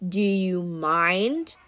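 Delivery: slow, lazy, put-upon Mister D; bored god interrupted at the fridge; very slow, lazy irritation; lazy annoyance. Acted. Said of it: "He looked up lazily".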